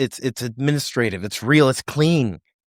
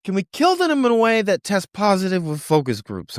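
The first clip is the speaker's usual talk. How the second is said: mock HR voice; 8 semitones higher than usual. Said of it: "That's H R asking".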